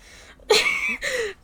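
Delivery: high, helpless giggling; loud tickled laugh. Acted. Partner keeps tickling the speaker, loud high laughter.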